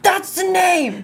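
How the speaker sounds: high, slow excited shout. Retold or imitated. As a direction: shout it out, thrilled. Excited band naming moment.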